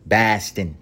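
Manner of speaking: exaggerated Boston vowel, "Boston" as "Bah-stin"; caricatured Boston "Boston" with a flattened A; Boston accent. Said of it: "they sound like fucking idiots".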